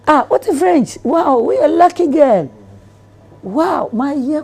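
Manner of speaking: high, loud laughing amazement; amazed and laughing. Retold or imitated. Businessman amazed at her French, high laughing praise. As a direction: gush in delighted disbelief. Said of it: "He's like".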